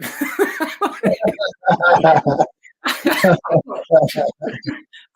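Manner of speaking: high, loud burst of laughter; helpless high laughing fit. Real, not acted. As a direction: crack up at your own story. Cracks up at own story.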